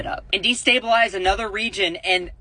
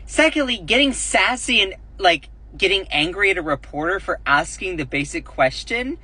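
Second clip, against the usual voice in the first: normal talking voice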